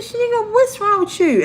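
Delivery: high-pitched imitation of a questioning teacher; retold teacher's high-pitched question; concerned teacher. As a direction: voice the teacher high, pressing for an answer.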